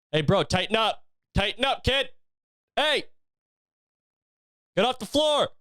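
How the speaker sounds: put-on high, sharp yell at a toddler on the floor; mock scolding yell. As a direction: bark it at the kid like a fed-up uncle. Scolding his tantruming toddler nephew.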